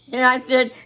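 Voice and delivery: normal talking voice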